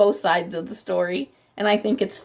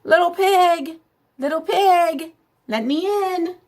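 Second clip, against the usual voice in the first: high coaxing call; sing-song high "little pig"; wolf calling sweetly; 8 semitones higher than usual. Acted. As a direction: coax the pig to open up. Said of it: "I called".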